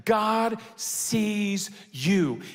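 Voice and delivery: "God sees you" drawn out very slowly, each word weighted; emphatic climax. Real, not acted. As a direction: proclaim "God sees you" one long word at a time. Climactic repeat to congregation.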